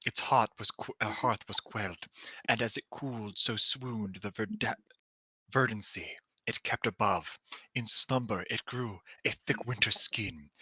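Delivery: soft, hushed poetic reading; poem reading. Acted. Reading the dream journal to friends, hushed poetic reading. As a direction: read it like a quiet dream.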